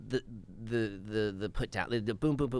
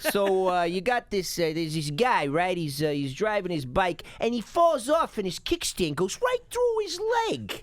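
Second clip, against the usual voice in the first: loud put-on voice rising much higher; mobster storyteller; 5 semitones higher, 4 dB louder than usual. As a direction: spin the gory tale like a hammy gangster. Telling bedtime story in character.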